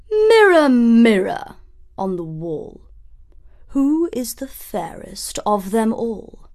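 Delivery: commanding queen calling her mirror; vain queen. A narrator's reading. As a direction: call out grandly to the mirror. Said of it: "she asked".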